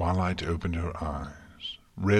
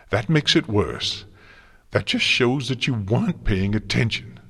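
loud, raised-pitch scolding; angry reproach; 5 semitones higher, 7 dB louder than usual. A narrator's reading. Twilight scolding Celestia for not paying attention, angry. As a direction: drive each accusation home loudly. Furious student scolds mentor.

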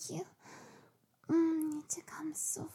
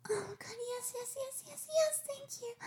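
rising higher and higher yeses; ecstatic yes; 8 semitones higher than usual. Acted. Girl thanking Daddy in a rising, ecstatic cry of yes. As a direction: climb up into breathless high yeses.

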